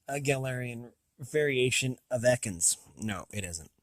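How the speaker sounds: ordinary talk, nothing marked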